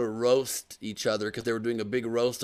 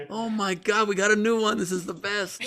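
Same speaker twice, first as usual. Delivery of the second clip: ordinary delivery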